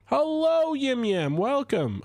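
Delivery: high, bright sing-song greeting; sing-song hello. Real, not acted. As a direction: call out a cheery hello. Greeting a player who joined.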